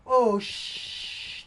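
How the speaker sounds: high loud startled hush; sudden hushing. Retold or imitated. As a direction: jolt up, then hush. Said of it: "he's there to, like, go".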